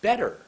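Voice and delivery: BETTER high and loud, falling; thoughtful emphasis. Shown as a demonstration. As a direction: punch BETTER high, then drop. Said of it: "Very thoughtful".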